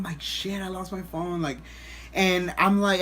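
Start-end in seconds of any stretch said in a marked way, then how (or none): none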